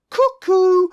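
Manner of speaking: high, loud sing-song cuckoo; playful two-note cuckoo, pitched high; cuckoo call. Retold or imitated. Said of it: "goes around to people going".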